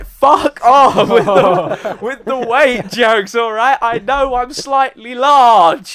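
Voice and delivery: slow, loud, high laughing protest; laughing mock indignation. Real, not acted. Mock offended at weight jokes.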